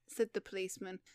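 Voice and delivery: plain reading voice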